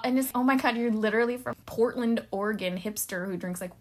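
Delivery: ordinary talk, nothing marked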